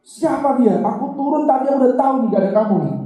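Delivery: high, loud furious questioning; angry old man. Retold or imitated. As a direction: demand answers, boiling over. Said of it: "Marah sih itu".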